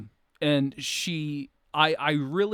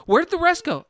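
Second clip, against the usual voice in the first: high, louder startled question; lightly acted, higher and louder demand; acted movie quote; 7 dB louder than usual. Retelling the man asking the girl about pills, acted high and loud.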